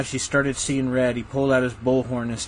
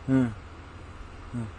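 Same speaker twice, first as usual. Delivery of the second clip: normal talking voice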